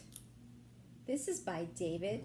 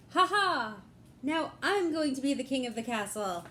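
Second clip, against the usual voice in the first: loud mocking villain laugh; villain's gloat; 3 semitones higher, 5 dB louder than usual. Dark Knight gloating after stealing the horse, loud laugh. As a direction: gloat like a triumphant baddie.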